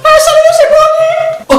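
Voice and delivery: voix suraiguë et lente de chien en plastique; Bouni le chien. Retold or imitated. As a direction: singe le chien en plastique, très haut et traîné. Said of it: "la voix avec l'accent anglais de son chien". (imitation suraiguë et lente du chien Bouni)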